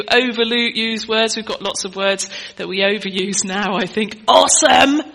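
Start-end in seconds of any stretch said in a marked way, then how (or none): 4.3-5.0 high, loud, gushing Awesome with a laugh; over-the-top mock enthusiasm; mock excited. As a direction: ham up the teen superlative. Mimicking overused superlative.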